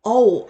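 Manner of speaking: 어오 as a glide from 어 into 오; 어오 said distinctly as one gliding vowel; variant pronunciation. Shown as a demonstration. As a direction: demonstrate 어오, sliding from 어 to 오. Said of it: "요런 느낌으로 다양하게 발음이".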